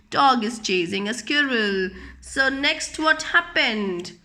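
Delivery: ordinary delivery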